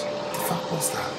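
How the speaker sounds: breathy high whisper of disbelief; hushed high whispered what-the-hell. Real, not acted. Repeats to himself.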